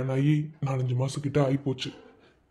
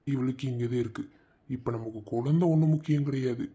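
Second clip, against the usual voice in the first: much softer, low thinking aloud; quiet inner monologue; 4 semitones lower, 6 dB quieter than usual. Acted. Husband resolving to find out why his wife refuses a child, in a soft inner voice. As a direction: muse to yourself, barely voiced.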